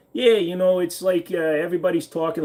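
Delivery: showing off a light New York accent. Shown as a demonstration. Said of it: "what's the New York sound like? Let's hear it".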